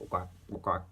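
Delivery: plain reading voice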